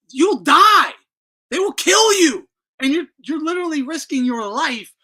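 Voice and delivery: high, loud warning; loud, rising warning; emphatic warning. Real, not acted. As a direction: hammer the danger home. Warning about mob poker rooms.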